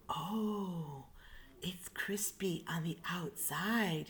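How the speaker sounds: soft whisper of wonder; whispered delight. Acted. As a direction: savor it in a whisper. Lion tasting, hushed.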